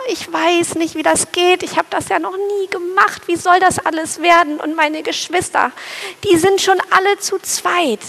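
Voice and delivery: a timid sparrow worrying aloud; anxious little sparrow. Acted. Fearful sparrow wondering where to build his nest, high anxious voice.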